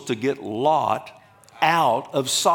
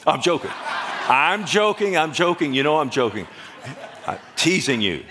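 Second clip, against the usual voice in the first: raised, laughing backpedal; laughing retraction; 4 semitones higher than usual. Real, not acted. Preacher takes back a Democrat joke to the congregation, laughing. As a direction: protest through laughter that it was only a joke.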